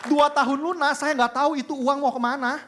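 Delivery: high, loud emphatic preaching; preaching at full force. Acted. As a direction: drive the point home to the congregation. (berkhotbah dengan penuh semangat)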